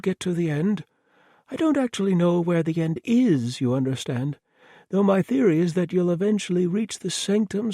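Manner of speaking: breezy murderer, perfectly reasonable; cheerful mad villain. Acted. Thomas voiced as cheerful madman.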